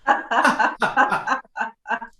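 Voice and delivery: high, loud staccato laugh; demonstrated laugh. Shown as a demonstration. Coach has class laugh to feel support muscles, bouncing laugh. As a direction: laugh as real as it can be. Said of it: "give me a little laugh".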